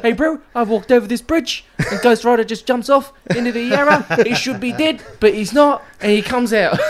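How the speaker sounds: high exaggerated Kiwi accent. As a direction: ham up a Kiwi bloke telling a story. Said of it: "That is a Kiwi accent".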